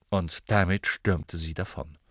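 plain reading voice

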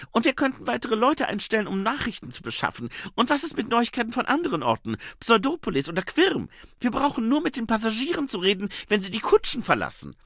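high, loud, eager woman's voice; Saccharissa. Acted. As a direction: pitch it up, pouring out ideas. (hohe, eifrige Frauenstimme)

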